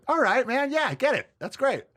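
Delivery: high, loud, amused; mock Rogan. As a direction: play up the impressed friend. Rogan reacts to sitcom brag.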